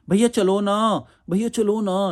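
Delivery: put-on high, pleading girly whine; mock feminine begging; man doing a woman's voice. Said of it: "लड़की की तरह आवाज़ में बात करने लग जाएंगे".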